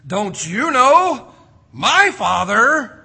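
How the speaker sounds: loud, high, slow stress on "my Father"; emphatic retort. Acted. Boy Jesus correcting his mother, emphatic on "my Father".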